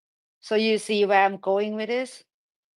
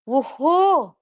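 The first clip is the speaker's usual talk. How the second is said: a real burst of joy, louder and higher; excited cheer; 5 semitones higher, 5 dB louder than usual. Real, not acted. Host cheering the Robo Van news to listeners, excited whoop. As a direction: whoop it out like great news just landed.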